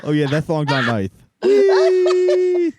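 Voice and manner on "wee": high, loud, slow giddy squeal; spinning dizzy dad. Acted. Dad spinning in the office chair, a giddy high squeal.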